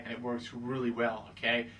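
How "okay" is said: ordinary talk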